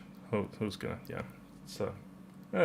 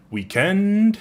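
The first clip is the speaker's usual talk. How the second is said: WEEKEND with its second syllable high and loud; British stress on WEEKEND; 10 semitones higher, 7 dB louder than usual. Shown as a demonstration. Showing how British people say it, stress on weekEND. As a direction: punch the END of "weekend", pitched high. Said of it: "British people say".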